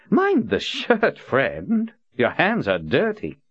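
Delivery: lordly buyer, pitched well up; haughty Prophet. A narrator's reading. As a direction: look her over like goods and name your price. Prophet scolding captain, haughty.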